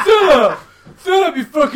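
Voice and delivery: laughing shout of a name; mock yell. Bully shouting at Philip in the schoolyard, loud mock yell.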